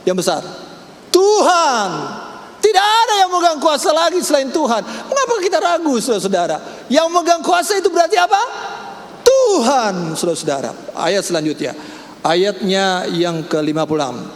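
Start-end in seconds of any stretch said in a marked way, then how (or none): 1.1-6.0 high, loud preaching cry; preaching at full force. Acted. Preacher proclaiming to the church, loud and high. 6.9-10.2 high, loud preaching cry; preaching at full force. Acted. Preacher proclaiming to the church, loud and high.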